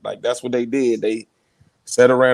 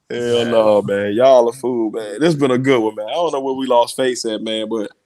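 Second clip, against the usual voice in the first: ordinary talk, nothing marked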